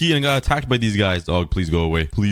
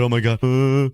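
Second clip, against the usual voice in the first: ordinary delivery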